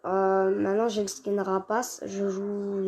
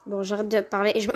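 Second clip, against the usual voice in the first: ordinary talk, nothing marked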